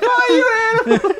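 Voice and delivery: high, squealing mock tantrum; hammed-up adolescent exit line; teen storming off. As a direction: screech it like a humiliated kid. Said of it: "And then the kid's like".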